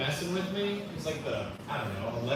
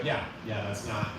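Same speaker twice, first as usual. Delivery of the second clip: low, quiet, noncommittal reply; low, softened, unconvinced mumble; no conviction; 7 semitones lower, 5 dB quieter than usual. Retold or imitated. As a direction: imitate a half-hearted yes, dropping pitch and volume. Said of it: "With no conviction".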